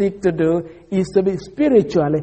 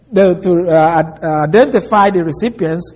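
ordinary talk, nothing marked

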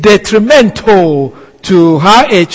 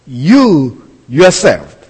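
"You yourself" very slow and lower, each word set apart; emphatic "You yourself"; 4 semitones lower, much slower than usual. Shown as a demonstration. Preacher showing where the emphasis falls, "You yourself" slow and stressed. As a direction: stretch out "You yourself" for weight. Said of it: "That's emphasis".

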